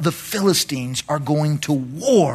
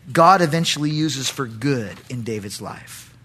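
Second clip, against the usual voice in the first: usual speaking voice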